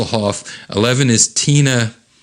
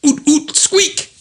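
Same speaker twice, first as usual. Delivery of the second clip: very high cartoon squeaks; squeaky nonsense; slower than usual. Kevin told to slow down, answering in high squeaks. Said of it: "And he says".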